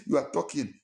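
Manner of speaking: usual speaking voice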